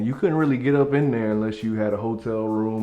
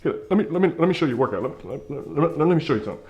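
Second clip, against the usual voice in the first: rapid, stumbling, higher "let me, let me"; fast talker; 6 semitones higher, faster than usual. Retold or imitated. Gym dude pushing his workout, rapid and stammering. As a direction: rattle it off like a wired hustler. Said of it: "He talked fast".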